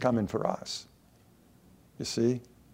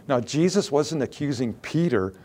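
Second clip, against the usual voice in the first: ordinary talk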